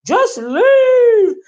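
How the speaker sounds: high, loud mock yell of leave; hollering woman impression. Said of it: "she was over there hollering".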